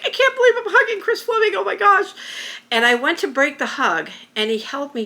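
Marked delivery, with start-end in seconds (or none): 0.0-2.1 much higher, giddy and laughing; fast, high, giggling fangirl gush; giddy schoolgirl. Retold or imitated. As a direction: squeal it like a starstruck fan. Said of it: "giddy schoolgirl again".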